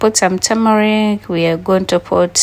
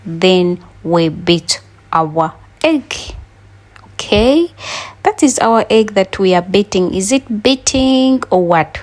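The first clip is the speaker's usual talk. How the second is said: usual speaking voice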